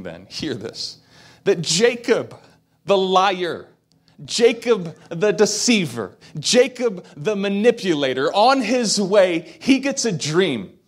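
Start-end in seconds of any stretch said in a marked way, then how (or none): 1.4-10.8 high, loud, punched preaching build; fiery sermon build; preaching at full force. Real, not acted. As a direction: hammer each name like a pulpit climax. Preacher builds emphatic point.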